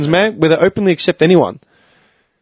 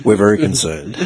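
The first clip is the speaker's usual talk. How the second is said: low, put-on solemn parent; mock-grave concern in a deep voice; 8 semitones lower than usual. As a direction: play the worried parent, pitched right down. Acting parents' mock intervention.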